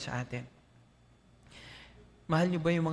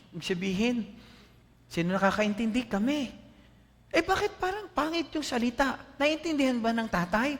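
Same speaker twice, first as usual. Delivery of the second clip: ordinary talk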